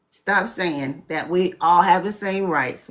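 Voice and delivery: ordinary talk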